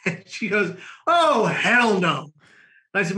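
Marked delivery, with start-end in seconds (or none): 1.1-2.3 drawn-out, raised-pitch mock outrage; emphatic refusal. Date refusing to let him stay over, emphatic refusal. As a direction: act out her flat-out refusal, stretching every word.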